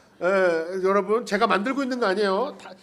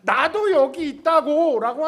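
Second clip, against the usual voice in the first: slow, higher, louder plea for attention; Leah's aching plea; 6 semitones higher, 5 dB louder, slower than usual. Retold or imitated. Leah pleading with Jacob to notice her, slow and aching. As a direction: beg your husband to look at you, drawn out. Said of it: "하는 레아의 아픈 마음이 있어요".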